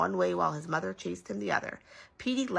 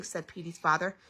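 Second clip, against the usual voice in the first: plain reading voice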